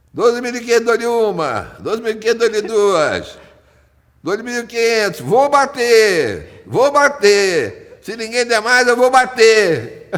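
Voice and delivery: loud, high auctioneer call. Retold or imitated. Auctioneer calling final bids.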